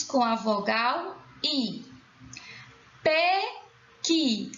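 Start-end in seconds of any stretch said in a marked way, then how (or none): 3.0-4.5 "Pequi" higher, in two separated syllables. Shown as a demonstration. Teacher asking for the stressed syllable, "Pequi" syllable by syllable. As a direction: split "Pequi" into pe, qui with a gap. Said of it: "Qual é a sílaba tônica?".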